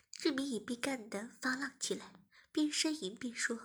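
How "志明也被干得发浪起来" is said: plain reading voice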